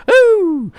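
high, loud ooh; a burst of glee; excited whoop. Real, not acted. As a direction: let out a sudden high whoop. Excited DJ yelp after quote.

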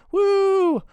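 high, loud celebratory whoop; cheering woo. Acted. Celebrating the five-star rating, a loud high woo. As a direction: let out a big victory whoop.

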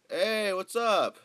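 big loud drawn-out hey; loud greeting. Real, not acted. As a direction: call it out slow and loud. Greets friend loudly on call.

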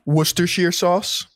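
Worcestershire said "WUUS-ter-sheer"; own pronunciation. Shown as a demonstration. Co-host showing how he says it, Worcestershire as "WUUS-ter-sheer". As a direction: end Worcestershire on "sheer", rising like a question. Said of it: "That's how I say it".

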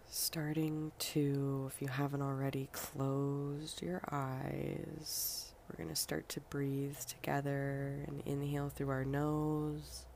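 guided breathing meditation. Acted. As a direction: lead each breath softly, leaving room to breathe.

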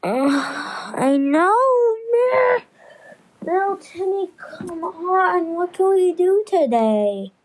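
put-on slow, high, whiny kid voice dragging out the boredom. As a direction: play a bored little kid begging for something to do. Acts a bored kid in a skit.

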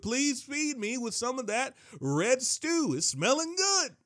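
lightly acted plea, pitched high; hungry Esau pleading. Weary Esau asks Jacob for some stew, high hammed-up pleading.

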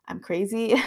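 ordinary talk, nothing marked

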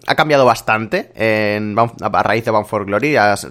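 ordinary talk

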